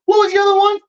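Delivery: high, loud "what was the other one"; mock startled outburst; startled question. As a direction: snap round in surprise. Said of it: "looked behind me and go".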